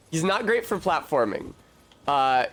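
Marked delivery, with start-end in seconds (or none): none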